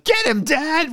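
put-on high-pitched kid yelling to Dad; mock yell. Kid Boba yelling from Slave One during a chase, high mock yell. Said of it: "just yelling".

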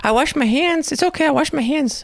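put-on very high little-boy voice; squeaky toddler insisting he washed his hands; imitating toddler. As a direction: repeat it like a tiny child. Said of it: "And then he's all".

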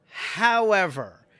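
a slow, loud, drawn-out however. Real, not acted. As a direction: lean hard on however before the rant. Rant turns on internet critics.

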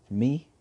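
"me" punched high, loud and quick; stress on me. Acted. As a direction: hit "me" hard and fast. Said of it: "Emphasizing the me with raising his eyebrows and quick enunciation".

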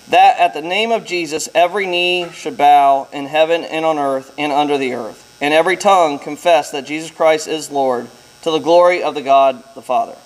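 ordinary talk

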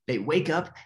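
usual speaking voice

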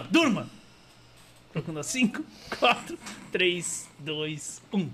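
firm command, then sinking countdown; hypnotist's fading countdown; hypnotic induction. Real, not acted.

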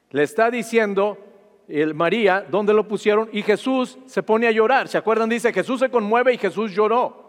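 rising pulpit fervour; fervent preaching. Acted. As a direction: preach it out to the congregation. Preaching builds up.

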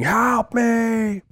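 high, drawn-out cry for help; mock scream. Retelling the trapped woman in the video, mock screaming. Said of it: "she's just screaming".

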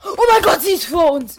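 high panicked shout; terrified scream. Pretend. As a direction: scream in fear. Monster ahead, screaming. (panischer Schrei)

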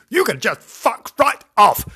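hammed-up angry brush-off; mock rude outburst. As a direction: tell her off loudly in character. Rude character line, mock.

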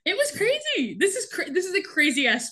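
very high, incredulous squeal; giddy disbelief. Real, not acted. Co-host marvelling at a crazy storyline, giddy high exclamation. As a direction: squeal it, still giddy from laughing. Amazed at the storyline after laughing.

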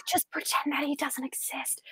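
hushed scheming whisper; a mother whispering the fake story to her husband; conspiratorial whisper. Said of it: "she's like".

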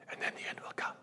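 whispered warning of the end; solemn whisper. Shown as a demonstration. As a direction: breathe the words like a secret. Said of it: "whispered quote of the verse".

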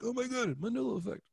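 high mock-amazed exclaim; mock gasp. Mocking people who discover the Mandela effect, high. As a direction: poke fun at easily amazed people.

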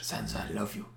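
put-on creepy, near-whispered Irish-Welsh accent; low, very soft Irish-Welsh murmur, creepy and gravelly; Littlefinger's accent. Mocking Littlefinger wooing Sansa, creepy Irish-Welsh accent. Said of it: "What the hell is his accent?".

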